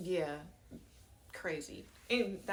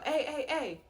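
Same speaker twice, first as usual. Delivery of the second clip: loud, high-pitched calling out; imitated yell; 8 semitones higher, 6 dB louder than usual. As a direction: call out sharply, imitating a man yelling for attention. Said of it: "I hear somebody yell".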